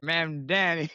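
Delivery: echoed high, affectionate mispronunciation; Al Sharpton impression. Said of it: "Al Sharpton said it with love".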